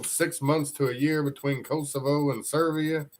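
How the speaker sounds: ordinary talk, nothing marked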